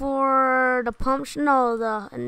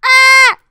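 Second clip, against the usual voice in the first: pained yell; 12 semitones higher, 18 dB louder than usual. Real, not acted. Gets killed.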